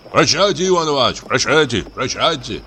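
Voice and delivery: high overlapping-style farewells; several voices answering. A narrator's reading. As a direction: call goodbye back as different men. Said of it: "ответило несколько голосов". (прощальные оклики нескольких голосов)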